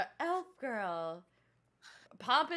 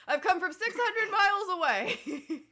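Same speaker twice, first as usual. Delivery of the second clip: louder and higher, laughing through it; louder laughing retake; 6 semitones higher, 6 dB louder than usual. Pretend. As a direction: belt the line again, laughing. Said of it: "sorry, I choked".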